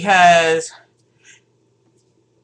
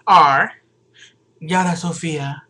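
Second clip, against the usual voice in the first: Stacy's voice; 4 semitones lower than usual. Shown as a demonstration. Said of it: "No, that's Stacy's voice".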